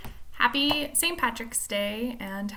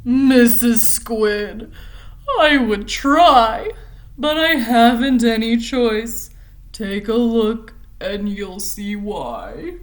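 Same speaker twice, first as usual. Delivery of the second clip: slow, louder, mopey fish voice; gloomy pout fish; 9 dB louder, slower than usual. Acted. The pout fish answers Mister Jelly, slow and mopey. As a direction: sigh it out, dragging each word.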